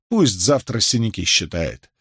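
usual speaking voice

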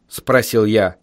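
ordinary delivery

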